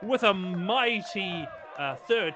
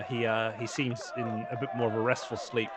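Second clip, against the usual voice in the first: ordinary talk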